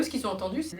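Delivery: normal talking voice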